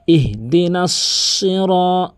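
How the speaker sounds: "সিরা" with a full, held heavy s; careful heavy sad. Shown as a demonstration. Teacher demonstrating the sad, slow careful recitation. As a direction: recite "ইহদিনাস সিরা" slowly, weighting the s. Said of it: "সতে উচ্চারণটা একটু".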